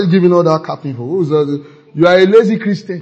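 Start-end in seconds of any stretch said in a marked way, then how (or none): 2.0-3.0 scolding. Acted. Preacher scolding the congregation.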